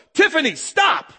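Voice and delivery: high, loud, drawn-out warning yell; urgent yell. Pretend. Parent to small child running at the street, urgent yell. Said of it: "Don't you wanna yell over and go".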